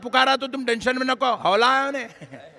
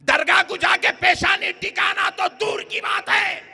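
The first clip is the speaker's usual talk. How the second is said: fiery preaching; 6 semitones higher than usual. Acted. Preacher rousing the congregation, fiery preaching. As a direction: hammer it home to the crowd.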